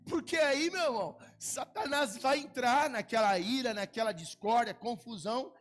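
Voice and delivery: loud, high preacher's cry against Satan; fiery preaching. Acted. Pastor warning the church about Satan, shouting. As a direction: thunder it out from the pulpit.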